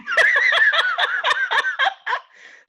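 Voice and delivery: long, loud laugh pitched far up. Real, not acted. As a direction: laugh hard at how mad it made you. Laughing about being annoyed.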